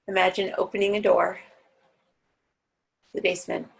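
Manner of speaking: guided meditation. Acted. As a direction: ease the class down into their hearts.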